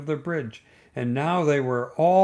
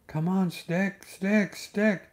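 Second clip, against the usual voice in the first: calling out; 4 semitones higher, slower than usual. A narrator's reading. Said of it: "calling out".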